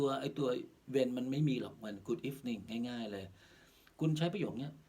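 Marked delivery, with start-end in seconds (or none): none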